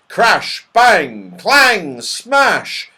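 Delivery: punchy, loud sound-effect words; loud crashing words. A narrator's reading. Narrator sounds out pans tumbling above, loudly punched. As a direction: punch out each crash separately.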